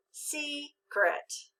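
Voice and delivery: "secret" as SEE-kret, split in two; long e, first-syllable accent. Shown as a demonstration. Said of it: "with the accent in the first syllable".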